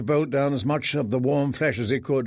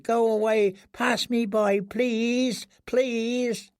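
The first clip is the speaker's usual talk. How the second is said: high, breaking please, please; desperate pleading; 9 semitones higher, much slower than usual. Acted. Prisoner begging the footsteps to pass, high desperate plea. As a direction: whimper a prayer to be spared. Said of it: "he prayed".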